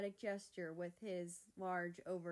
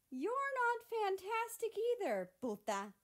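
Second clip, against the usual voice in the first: much higher, louder sassy voice; insulting retort; 7 dB louder than usual. Acted. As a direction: fire it back with sass. Mexico insults America back.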